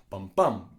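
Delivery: "bum, bum" with the second one punched loud; unstressed-stressed beat. Shown as a demonstration. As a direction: demonstrate an iamb, hitting the second "bum" hard. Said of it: "an unstressed, stressed syllable".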